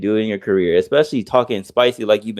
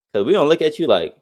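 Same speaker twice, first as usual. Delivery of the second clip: ordinary delivery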